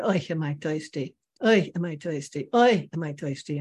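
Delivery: put-on old man's kvetch in a Yiddish accent. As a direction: complain repetitively with Yiddish-accented "oy". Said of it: "he talks in a Yiddish accent".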